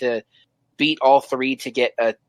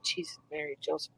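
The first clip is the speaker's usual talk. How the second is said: very soft, stunned oath under the breath; hushed disbelief; 17 dB quieter than usual. Retold or imitated. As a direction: drop right down in quiet amazement. Retelling awe at the high score.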